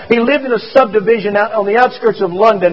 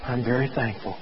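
soft, low muttered thanks; 6 semitones lower, 14 dB quieter than usual. A narrator's reading. Dying boy mutters thanks to the doctor, faint and low. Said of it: "Joe closes his eyes and muttered".

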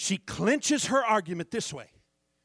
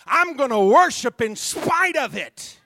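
loud call to repeat; 3 semitones higher, 9 dB louder than usual. Shown as a demonstration. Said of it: "somebody say".

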